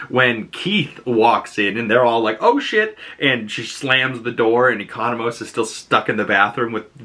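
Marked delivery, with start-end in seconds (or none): 2.4-3.0 high, startled mock panic; caught-off-guard yelp; alarmed. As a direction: blurt it out pitched up. Caught by Keith.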